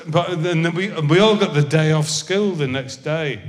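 normal talking voice

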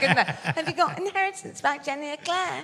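high, softened advert-customer voice; old advert parody. Retold or imitated. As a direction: parody the advert's polite bookshop enquiry. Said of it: "you remember that old advert".

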